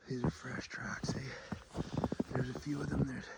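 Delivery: hushed tracker's whisper. Real, not acted. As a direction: breathe the words so wolves can't hear. Quietly tracking fresh wolf prints.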